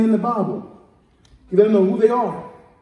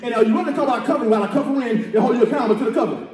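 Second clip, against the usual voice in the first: fast preaching rush; 3 semitones higher, faster than usual. Real, not acted. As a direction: rattle the question off fast. Preacher rushes the repeated question.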